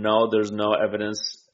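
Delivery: normal talking voice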